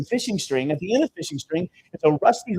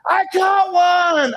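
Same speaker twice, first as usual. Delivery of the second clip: put-on much higher, louder, slow little-girl cry of joy; excited kid; 12 semitones higher, 4 dB louder, much slower than usual. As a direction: shout it like a small girl thrilled by her first fish. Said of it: "Jada goes".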